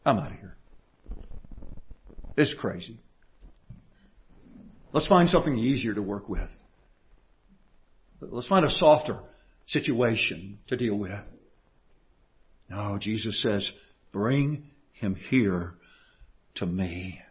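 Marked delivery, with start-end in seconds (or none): 0.0-11.2 a quiet, fed-up giving-up voice; lightly voiced weary teacher ready to quit; mock exasperated Jesus. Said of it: "He doesn't just say". 14.1-17.2 slow, soft, drawn-out call; slow gentle command. Acted.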